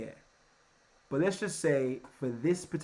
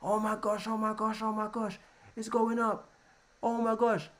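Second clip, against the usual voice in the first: higher, giddy oh my gosh; giddy trader watching price rise; mock giddy trader; 6 semitones higher than usual. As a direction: squeal like a hyped buyer. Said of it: "we start getting excited".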